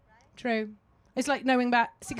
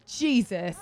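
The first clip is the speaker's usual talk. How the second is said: normal talking voice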